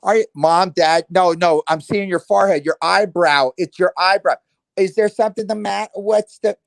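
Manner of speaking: loud, high calling into the phone. Retold or imitated. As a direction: shout to parents who can't hear. Yelling at parents on a video call.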